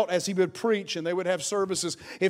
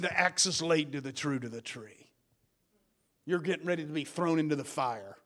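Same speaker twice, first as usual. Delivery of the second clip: low, soft ominous warning; John the Baptist warning; 8 semitones lower, 9 dB quieter than usual. Retold or imitated. Preacher voicing John the Baptist's warning, low and ominous. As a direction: drop the voice low and grave. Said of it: "He came and he said".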